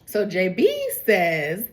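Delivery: ordinary delivery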